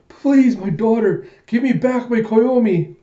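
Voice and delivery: high, loud begging; acted wail of a pleading parent; desperate plea. Bar owner begs for Koyomi back, high desperate plea. Father pleads for his daughter.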